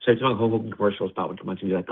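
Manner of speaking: ordinary delivery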